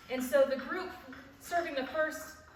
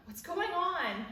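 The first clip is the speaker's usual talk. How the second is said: high, stretched-out anxious question; retold alarm; 7 semitones higher, 3 dB louder, slower than usual. Mother on phone to daughter after accident, retold alarm. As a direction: voice leaps up, slow and worried.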